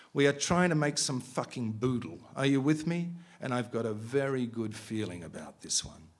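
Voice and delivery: steady, measured reading of a novel; story reading. A narrator's reading. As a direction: read it like an author at a bookshop. Author resumes reading novel.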